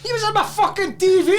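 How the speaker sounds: very high indignant squawk; mock outrage. Man retelling who was on his telly, high mock outrage. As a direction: pitch the complaint right up for the punchline. Retold outrage, builds to punchline.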